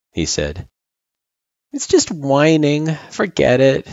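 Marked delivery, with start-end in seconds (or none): 1.7-3.9 put-on high sheepish child voice. A narrator's reading. Girl waving away her own whining, sheepish. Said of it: "she said sheepishly".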